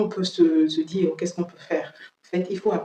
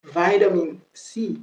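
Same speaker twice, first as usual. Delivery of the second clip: American accent; slower than usual. Shown as a demonstration. Teacher contrasting with British, American accent. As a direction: draw out "vitamin" slowly, American style. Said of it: "Ça, c'est les Américains".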